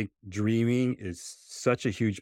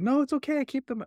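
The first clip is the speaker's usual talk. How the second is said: kind friends, voiced much higher; sweet little kids saying it's fine, pitched way up; neighborhood kids. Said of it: "they're like".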